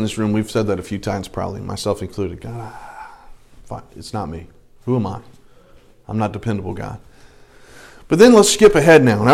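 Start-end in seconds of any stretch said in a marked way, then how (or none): 2.4-7.0 very soft, low acted resignation; reluctant self-doubt. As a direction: give in to doubt, barely voiced. Acts our reluctant reply to God.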